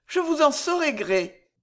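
voix aiguë de jeune femme reconnaissante. Acted. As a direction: une jeune courtisane qui remercie doucement. Esther répond au baron. (jeune femme reconnaissante, voix aiguë)

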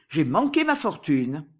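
ordinary delivery, nothing marked

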